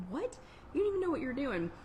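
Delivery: high-pitched imitated disbelief; mock disgust. Host reacting to a clueless contestant, mock disgust. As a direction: act out a baffled, disgusted game show host. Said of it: "the look of disgust, like".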